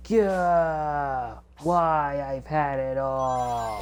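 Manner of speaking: drawn-out villain's cry of defeat; slow, stretched-out roar of frustration; Metal Overlord. Retold or imitated. Game fan acts out the beaten villain, slow and agonised. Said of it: "Metal Overlord goes".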